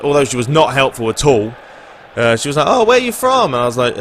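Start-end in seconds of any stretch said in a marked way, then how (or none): 2.7-3.5 high-pitched mock curious woman; imitated woman on the phone. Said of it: "the woman on there, she was like".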